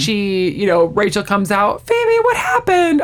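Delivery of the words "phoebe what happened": high, drawn-out mock dismay; alarmed question. Rachel comes out and sees the dress, alarmed question. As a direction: react to a friend's disaster.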